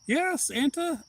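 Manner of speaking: high-pitched imitated cheer for Santa; singsong high quoted reply; acted excited reply. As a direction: lift it high in mock delight. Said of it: "she's like".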